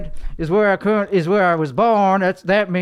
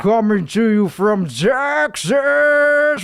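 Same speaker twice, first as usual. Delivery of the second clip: stupid voice; 3 semitones higher, slower than usual. Acted. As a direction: announce it like a cheesy host. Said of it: "Why the fuck is he talking so stupid like that?".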